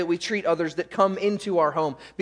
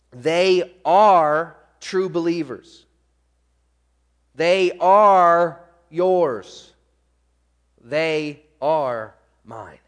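very slow, with "are" drawn out; deliberate stress on ARE; 6 dB louder, much slower than usual. Acted. Preacher sums up Jesus' prayer to Father, deliberate stress on "are". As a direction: spell it out word by word, leaning on "are".

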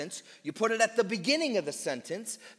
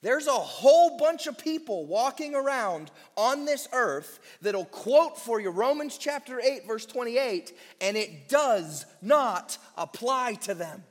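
higher, louder sermon delivery; impassioned preaching; 4 semitones higher, 4 dB louder than usual. Acted. Preacher warning about false believers, raised and intense. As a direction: press the point hard on the pews.

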